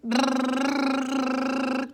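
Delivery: loud lip trill, blowing the lips; loud lip buzz demo. Shown as a demonstration. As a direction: blow the lips like a motor. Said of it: "If you can't roll your R's, go".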